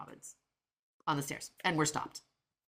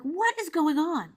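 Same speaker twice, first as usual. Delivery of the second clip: high, drawn-out exasperated question; acted-out mom losing patience; exasperated demand; 13 semitones higher, 4 dB louder, slower than usual. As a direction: slowly demand to be let in on it. Retold demand to whispering daughters.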